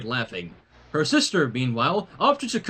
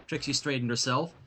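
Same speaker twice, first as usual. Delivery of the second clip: usual speaking voice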